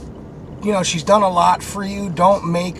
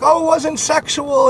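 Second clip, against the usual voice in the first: mock girlfriend voice; 8 semitones higher than usual. Mimicking girlfriend's excuse.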